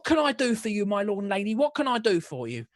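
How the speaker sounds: high, loud Cockney through laughter; Cockney baker woman, laughing. Acted. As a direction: gush at the nobles in Cockney, half laughing. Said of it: "English accents coming around".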